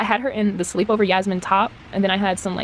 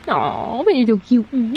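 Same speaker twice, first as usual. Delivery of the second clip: cooing at pet; 3 semitones higher, much slower than usual. Real, not acted.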